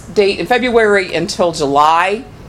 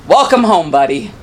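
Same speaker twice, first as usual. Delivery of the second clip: mild retold yell; 4 dB louder than usual. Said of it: "he yelled across the street".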